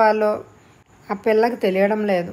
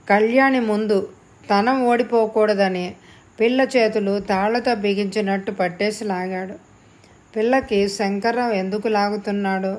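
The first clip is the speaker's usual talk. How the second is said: plain reading voice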